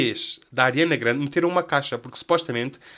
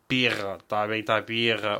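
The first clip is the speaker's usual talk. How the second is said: posh beta accent; 3 semitones lower than usual. Shown as a demonstration. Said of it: "Olhem outro sotaque, um sotaque de beta".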